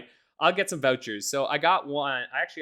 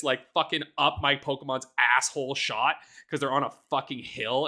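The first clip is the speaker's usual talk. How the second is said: normal talking voice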